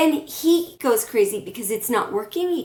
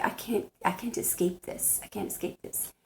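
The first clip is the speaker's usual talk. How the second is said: whispered, softer, a stuck and hopeless voice; trapped whisper; 11 dB quieter than usual. Retold or imitated. Voicing the woman tied to the fence, in a soft despairing whisper. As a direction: breathe it out like someone who can't get free. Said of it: "it feels like".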